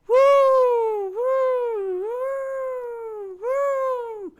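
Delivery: very high, drawn-out hoots; high playful woo-hoos; children hooting. A narrator's reading. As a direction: hoot in falsetto like charging kids. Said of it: "the children hooted".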